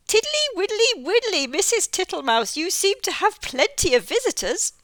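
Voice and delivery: high, loud toad sing-song. Acted. Mister Jackson the toad teasing Missus Tittlemouse about her visitors.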